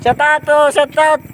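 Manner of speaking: high call. Real, not acted. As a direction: sing out the shout out. Calling out a shout out.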